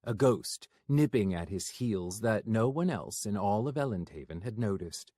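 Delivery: usual speaking voice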